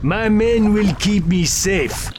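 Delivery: slow, rising menacing boast; villain warning off the intruder; arrogant villain. Acted. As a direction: savour each threat slowly. Villain at private party.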